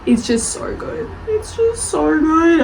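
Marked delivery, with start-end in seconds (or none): 1.3-2.6 higher, slower, voice welling up; emotional, near tears. Real, not acted. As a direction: let it get to you, almost crying. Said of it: "I'm gonna cry about it now".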